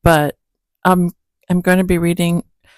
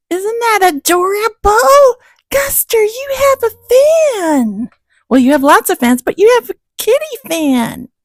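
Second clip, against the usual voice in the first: cooing to the cat; 3 dB louder than usual. Real, not acted.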